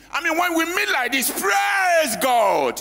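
exultant preacher call; preaching at full force. Acted. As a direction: lift the room, shout praise to God. Preacher calling out praise.

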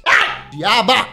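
startled yell. Retold or imitated. Startled by ghostly girlfriend.

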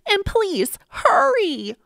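loud, high urgent yell. A narrator's reading. Boy urges the firemen on, high pleading yell. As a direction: cry out a plea for speed. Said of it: "I yelled".

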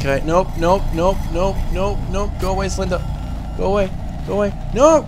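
rising frantic pleading; panicked. Real, not acted. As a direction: beg it to go away in panic. Slender Man approaching.

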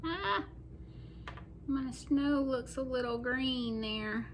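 ordinary delivery

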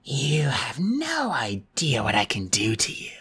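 slow, whispered harsh croak. Acted. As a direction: croak it out, menacing. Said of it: "her voice was a harsh croak".

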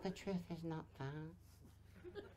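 very soft, slow and feeble; feeble low volume. Shown as a demonstration. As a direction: mimic a voice with no power. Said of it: "if you're talking like that".

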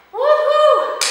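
high, very loud "woohoo"; celebratory whoop. Real, not acted. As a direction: whoop it out at the finish line. Celebrates finishing the last set.

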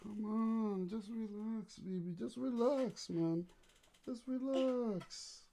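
soft, hushed lullaby voice. Real, not acted. Dad soothing waking baby back to sleep.

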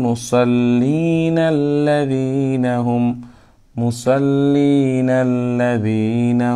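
slow chant with the words run together; slow, linked Quranic chant; joined recitation. Shown as a demonstration. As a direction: link the words in one slow breath. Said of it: "তখন এভাবে হবে".

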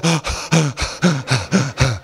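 sharp frightened intake of breath; panicked gasp. Retold or imitated. Claustrophobic people in a lift, gasping in panic. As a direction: gasp as if the walls close in. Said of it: "and they go".

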